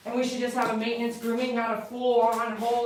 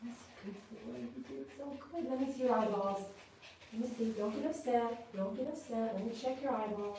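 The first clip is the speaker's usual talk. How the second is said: soft, gentle reassurance to a dog; soothing pet talk; 8 dB quieter than usual. Real, not acted. Groomer soothing a dog during a face check, soft and gentle. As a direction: hush and coax a nervous dog.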